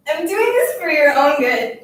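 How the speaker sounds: loud, slow, overwrought justification; tearful insistence. Acted. Girl justifying herself to the classmate she bullies, tearful insistence. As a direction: insist loudly, dragging out the words. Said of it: "I couldn't stop crying and talking".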